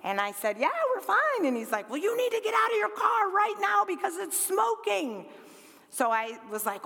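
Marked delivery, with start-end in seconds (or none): 0.6-1.5 high, airy retold reply; quick reassurance. Telling the stranger we're fine, high reassurance. 1.9-5.3 high-pitched, insistent urging; urgent warning. Retold or imitated. Stranger telling her to get out, urgent.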